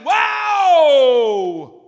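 high, loud, long-held whoop; over-exaggerated cheer. Shown as a demonstration. Preacher cheering someone else's slam dunk, over-exaggerated shout. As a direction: let out a huge falling holler. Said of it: "I'm over-exaggerating".

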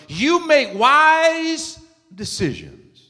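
high, slow, stretched praise; emphatic affirmation. Shown as a demonstration. Preacher modelling praise for wise choices, emphatic and slow. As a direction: lift the pitch and draw it out.

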